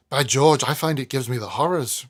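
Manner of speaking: put-on loud, spooked young lodger; Weevil voice. A narrator's reading. Weevil confessing his dread to Snagsby, loud and rattled. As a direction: blurt it out, rattled.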